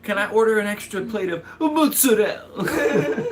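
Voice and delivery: high, mock diner bursting into exaggerated Italian accent; show-off Italian code-switch. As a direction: order loudly, hamming up an Italian "mozzarella". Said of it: "When people do that".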